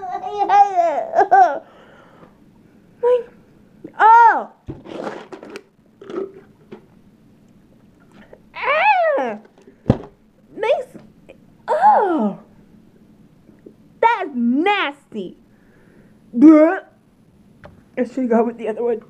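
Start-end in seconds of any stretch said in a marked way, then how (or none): 0.1-15.4 high disgusted whine. Real, not acted. Tasting a disgusting jelly bean. 16.3-16.8 loud gagging bleh; disgusted bleh. Real, not acted. Gagging at the taste.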